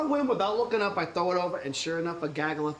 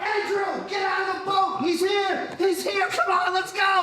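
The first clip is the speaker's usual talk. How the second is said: high shout across the water; calling out; 14 semitones higher, slower than usual. Acted. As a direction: yell to him in the boat. Peter calls brother from boat.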